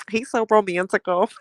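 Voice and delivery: mock-swooning over a teddy bear gift; swooning girl. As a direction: gush like a smitten girl. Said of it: "I'm just like".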